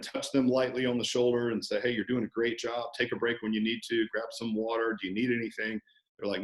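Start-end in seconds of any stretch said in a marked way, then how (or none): 1.8-5.8 softer, gentle check-in with a newcomer; soft, reassuring. Acted. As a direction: speak softly, as if touching their shoulder lightly. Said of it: "talking softly and touching, uh, a new person".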